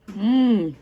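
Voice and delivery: loud yummy hum; loud appreciative mm. Real, not acted. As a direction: hum with delight at the taste. Savoring food.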